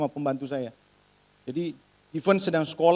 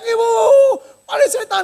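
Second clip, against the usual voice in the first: mock scream; 9 dB louder than usual. Maid calling her employer about a devil, hammed-up scream. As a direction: imitate a terrified maid screaming. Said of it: "dia teriak".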